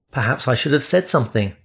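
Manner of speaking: ordinary delivery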